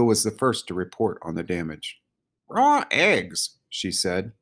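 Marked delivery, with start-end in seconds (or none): 2.5-3.6 high-pitched character voice; small high animal voice; crow character voice. A narrator's reading.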